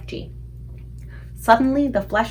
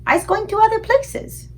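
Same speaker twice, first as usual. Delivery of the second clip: much higher, evasive giant; higher BFG voice; 14 semitones higher, 3 dB louder than usual. A narrator's reading. BFG dodging the giants' question, high voice. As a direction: slip the answer out lightly.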